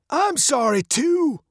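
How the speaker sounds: put-on high-pitched old man's voice. A narrator's reading. Old man replies to the angry father, ominously. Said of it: "the old man said".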